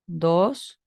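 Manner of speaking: "dos" subiendo; tono dos. Shown as a demonstration. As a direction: haz subir "dos" como segundo tono. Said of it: "el tono dos que sube". (segundo tono ascendente)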